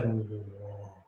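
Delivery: low, soft, drawn-out whoa; long, relaxed low drone; slow-motion whoa. Shown as a demonstration. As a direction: stretch it out as if time slows down. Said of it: "one second for him was like".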